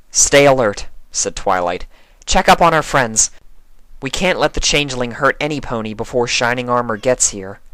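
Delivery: a hint of a calm leader; lightly voiced reassuring unicorn; lightly voiced Twilight.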